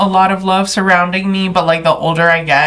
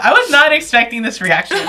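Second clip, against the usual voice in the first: high voice breaking into laughter; laughing surprise; 6 semitones higher than usual. Real, not acted. Host caught off guard by guest's reaction, laughing.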